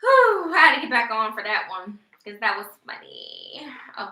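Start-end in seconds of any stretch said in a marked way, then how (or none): none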